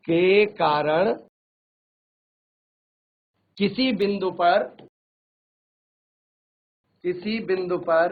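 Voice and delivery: each word drawn out slowly for note-taking; slow dictation. Shown as a demonstration. Teacher slowly dictating a phrase to students. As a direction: spell it out slowly so the class can write. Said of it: "इसको ऐसे लिख लो".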